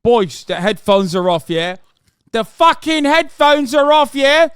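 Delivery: mock British lad. Streamer mocking the Gamergate guy, in a hammed-up British lad voice.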